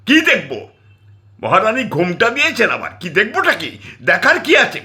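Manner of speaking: high, loud sneering retort; contemptuous shout. Acted. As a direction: spit out scorn at the veiled woman. Wife mocks mother-in-law.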